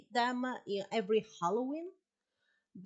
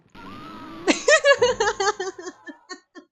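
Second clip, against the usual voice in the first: big loud belly laugh; loud burst of laughter; 11 semitones higher, 14 dB louder than usual. Real, not acted. Trap works, bursts out laughing.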